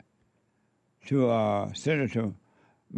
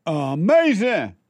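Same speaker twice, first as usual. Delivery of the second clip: emphatic amazement; 4 semitones higher, 5 dB louder, slower than usual. Real, not acted. Exclaiming praise for Trump.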